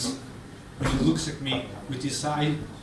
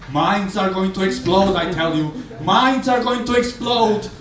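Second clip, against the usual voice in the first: panicked warning; 5 semitones higher, 6 dB louder than usual. Retold or imitated. Friend warns in panic.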